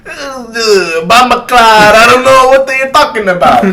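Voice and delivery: hammed-up Jamaican accent impression. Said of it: "let me do a Jamaican accent".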